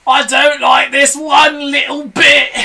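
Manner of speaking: high, loud, slow frightened shout; Mr Twit crying out. A narrator's reading. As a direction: wail it in panic. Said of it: "cried Mister Twit".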